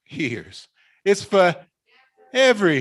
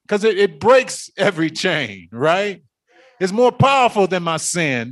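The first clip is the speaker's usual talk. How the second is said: higher and fast, rising fervor; full-force preaching; 6 semitones higher, 5 dB louder than usual. Acted. Preacher calling the congregation to deliverance, fervent. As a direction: preach it, call them in.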